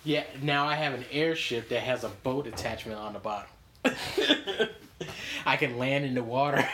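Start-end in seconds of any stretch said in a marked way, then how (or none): 3.8-5.4 laugh. Real, not acted. Laughing at airship boat.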